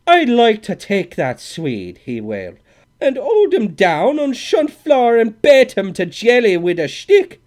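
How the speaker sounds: loud, high Irish brogue wail. A narrator's reading. Old Scully raging at the Swede, loud Irish brogue. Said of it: "burst into sudden brogue".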